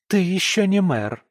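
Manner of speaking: high, loud disgusted jab; wife sneering at her husband; disdainful. Acted. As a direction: sneer it at him. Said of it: "брезгливо кривится".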